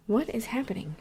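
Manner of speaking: puzzled hushed whisper. Real, not acted. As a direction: whisper it, suddenly confused. Startled mid-recording, asks aloud.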